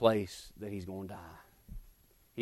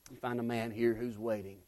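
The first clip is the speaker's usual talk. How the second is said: much softer, dropped to a hush; hushed repeat; 2 semitones lower, 15 dB quieter than usual. Acted. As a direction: pull it back almost to a murmur. Preacher repeats line, then drops.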